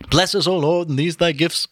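high-pitched mock prayer; intoned grace. A narrator's reading. Mulligan saying grace at breakfast, intoned. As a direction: intone it like a priest.

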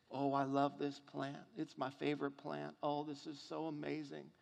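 quiet, dreamy Jonah cooing over his plant; soft mock delight. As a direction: coo softly like a man in love with a plant.

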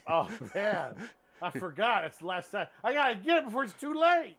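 high, loud panicky hammed-up ad read; mock panicked celebrity pitchman; Brian Cox impression. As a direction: ham up a frantic McRib ad. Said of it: "He always sounds like".

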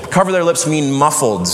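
normal talking voice

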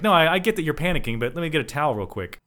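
fast, high urgent concern; concerned person. Retold or imitated. Cohost voicing a concerned friend, quick high urgency. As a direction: hurry to fetch a towel. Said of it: "even a concerned person would be like".

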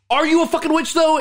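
high, loud accusing demand; mock accusing yell. Father yelling at his daughter, demanding if she's a witch.

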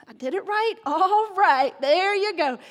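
hammed-up pleased reaction; self-congratulating. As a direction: cheer yourself on playfully. Imagines own delighted reaction to greeting.

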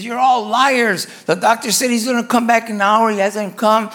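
retold angry outburst at the nurses; imitated mad rant. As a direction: act out a fed-up patient ranting. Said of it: "He's all mad and everything".

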